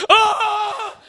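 sudden high cry of pain; mock cramp yelp. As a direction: yelp like a cramp just hit. Mimicking a charley horse cry.

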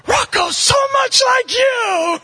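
hammed-up acquaintances gushing to the dad; mocking onlookers. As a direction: ham up nosy guests gushing. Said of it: "they always go".

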